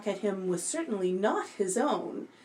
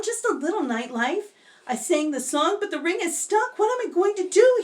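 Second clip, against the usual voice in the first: higher, frantic begging; pleading; 10 semitones higher, 3 dB louder than usual. Acted. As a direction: beg desperately, rushing. Anthony begs the witch for help.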